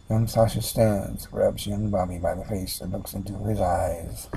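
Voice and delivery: normal talking voice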